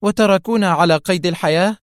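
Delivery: high-pitched, incredulous question; exclaiming. A narrator's reading. Mona asks why they were spared, exclaiming. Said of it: "هتفت".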